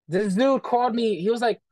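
usual speaking voice

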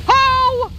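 sudden high loud shriek of shock; startled yelp. Real, not acted. Player taking a huge hit, shocked yelp. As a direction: caught off guard mid-sentence, yelping.

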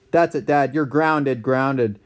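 ordinary delivery

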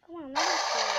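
loud pleading yell; loud frustrated plea at the game; frustrated shout. Real, not acted. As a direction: yell it at the screen.

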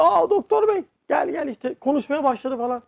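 high, eager call to the doctor; excited relatives. Retold or imitated. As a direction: call him over, thrilled. (sevinçle, tiz sesle doktoru çağırma)

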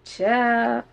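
normal talking voice

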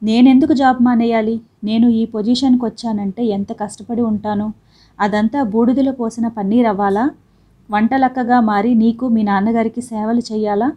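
plain reading voice

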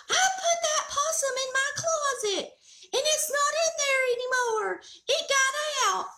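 high excited near-yell; frantic shout. Retold or imitated. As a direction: shout the bad news in alarm. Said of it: "He goes".

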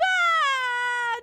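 loud, high drawn-out cry; long frustrated wail. Real, not acted. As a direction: wail open-throated. Wail over missing lamp.

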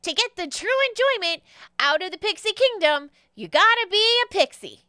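high, emphatic pixie pitchman; Jeremy the pixie. Acted. Pixie hands over a potion, high and emphatic. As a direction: punch it out in a high little showman's voice. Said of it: "And he says".

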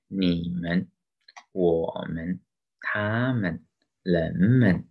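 你们, 我们, 他们, 人们 with a light 们; neutral-tone demo. Shown as a demonstration. Teacher reads examples, 们 in 你们 and 人们 in neutral tone. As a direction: draw out the first syllable, keep 们 short in 你们, 我们, 他们, 人们. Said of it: "有三种neutral tone啊".

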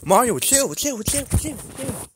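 urgent pleading. Acted. Begging Mario to stop.

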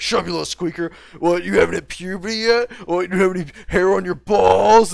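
mocking grown man. Grown man on voice chat mocking a kid, loud taunt. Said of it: "sometimes they're a grown-ass man, be like".

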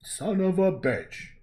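slow loud high movie shout; hammed-up action-hero bellow; Arnold quote. Vlogger quoting Arnold, slow loud mock bellow. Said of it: "Arnold Schwarzenegger saying".

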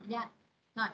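ordinary delivery

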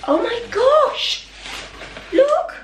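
very high, slow delighted oh my gosh; delighted squeal. Real, not acted. Unboxing Coronation mugs, she squeals with delight.

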